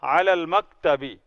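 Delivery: علی المکتبی said as ala-lmaktabi, slowly; slow, running علی into المکتبی; joined Arabic reading. Shown as a demonstration. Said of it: "تو اس کو علی ال".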